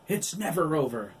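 high, loud scream. A narrator's reading. Billy screaming at Oliver in the fight. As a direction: scream it defiantly. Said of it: "Billy screamed".

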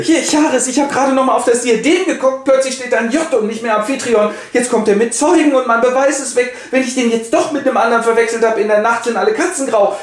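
put-on high, worried young wife; Alkmene voice. Alkmene worries aloud to Charis. (hohe, besorgte Frauenstimme)

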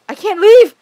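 high, loud frantic yelling; panicked shouting. Acted. Gamer voicing a frantic character shouting at the kid. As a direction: yell it like you're cornered.

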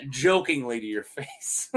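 normal talking voice

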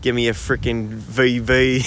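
slow-starting, put-on Aussie accent; Australian accent. Streamer shows chat how a proper Australian bloke sounds, mock Aussie accent. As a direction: imitate the little tones of a proper Australian bloke. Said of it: "little tones like this going on".

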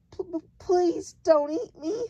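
put-on high, stammering little girl's plea; frightened stammer. A narrator's reading. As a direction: stutter the plea in terror. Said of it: "Sophie stammered".

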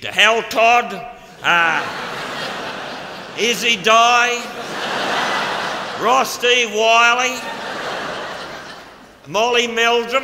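drawn-out proud roll call; proud listing. Retold or imitated. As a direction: announce each name like a roll call. Listing teachers with emphasis.

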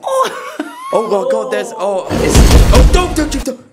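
high laughing yelps; startled laughing shriek. Real, not acted. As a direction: squeal and laugh in fright. Startled by the cat.

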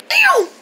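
shrieking cartoon bird call; high bird cry. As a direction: wail like a bird alarm. Imitates crying bird.

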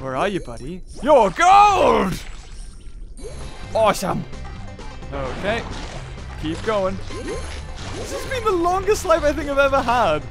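ordinary delivery

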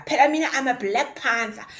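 usual speaking voice

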